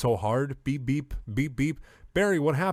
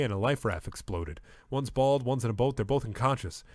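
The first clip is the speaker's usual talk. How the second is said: usual speaking voice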